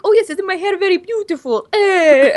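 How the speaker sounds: put-on high, hammy Italian accent; mock Italian accent. Answering kids as Michelangelo, hammed-up Italian accent. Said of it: "I was like".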